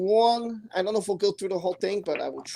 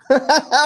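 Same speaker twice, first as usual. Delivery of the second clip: high, loud real laugh; burst of laughter; 6 semitones higher, 7 dB louder than usual. Real, not acted. Host reads a comment on his accent, bursts out laughing. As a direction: crack up suddenly.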